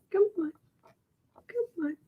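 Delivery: soft high pet coaxing. Real, not acted. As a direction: coax the cat gently up high. Coaxing the cat.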